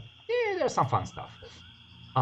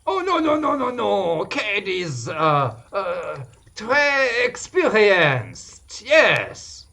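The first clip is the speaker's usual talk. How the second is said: put-on French accent, high and drawn-out on très; high hammy French accent; 3 semitones higher, 4 dB louder, slower than usual. Adventurer boasts in a hammy French accent. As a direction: lay the French on thick. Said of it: "French accent in English".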